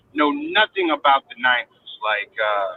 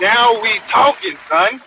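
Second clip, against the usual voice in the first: hyped; 8 semitones higher, slower than usual. Real, not acted. Hyped at nineties segment.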